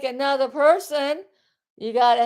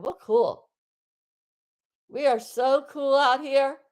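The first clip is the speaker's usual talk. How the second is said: ordinary talk, nothing marked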